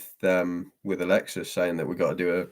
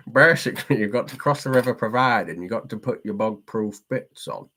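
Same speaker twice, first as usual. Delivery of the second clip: put-on lilting, raised Yorkshire accent; 3 semitones higher than usual. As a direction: explain it in a playful Yorkshire accent. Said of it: "Yorkshire's easy".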